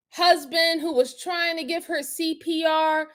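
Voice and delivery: normal talking voice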